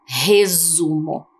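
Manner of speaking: resumo, very slow with the s buzzed as z; slow z sound. Shown as a demonstration. Teacher sounding out a word for children. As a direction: draw out resumo to show its z. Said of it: "na segunda palavra é resumo?".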